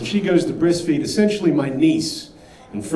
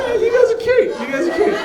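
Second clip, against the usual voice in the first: much higher sing-song teasing coo; mock cute; faster than usual. Real, not acted. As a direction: coo at the crowd condescendingly. Teasing the audience.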